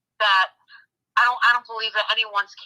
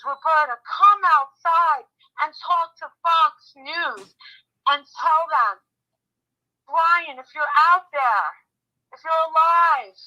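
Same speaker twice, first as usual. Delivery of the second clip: higher, drawn-out public plea; re-enacted appeal, voice raised; pleading call-out; 5 semitones higher than usual. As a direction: call out slowly to someone inside the house. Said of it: "I kept saying over and over again".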